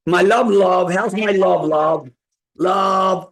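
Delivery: light up as she calls; greeting his love. Real, not acted.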